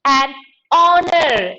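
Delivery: "honor" starting on a long O, no H; silent H. Shown as a demonstration. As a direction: stretch "honor" slowly, H dropped. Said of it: "H is silent".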